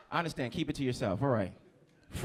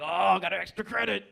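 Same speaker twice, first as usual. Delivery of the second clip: higher-pitched mock arcade excitement; excited; 5 semitones higher, 3 dB louder than usual. Man acting out a video game win, mock excitement. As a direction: act thrilled like a kid at a video game. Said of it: "we get excited".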